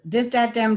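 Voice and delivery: "Dis, dat, dem" with hard d. Shown as a demonstration. Teacher listing the regional d for th words. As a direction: spit out each d in place of th. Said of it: "instead of this".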